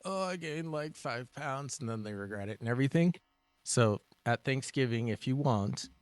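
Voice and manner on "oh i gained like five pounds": high, slow whiny moan; mock whiny complaint. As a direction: whine it like a regretful eater. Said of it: "they complain and say".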